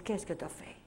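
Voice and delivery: husband asking quietly at night, hushed; hushed question. Retold or imitated. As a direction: ask it under your breath in the cabin. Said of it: "Vincent, il dit".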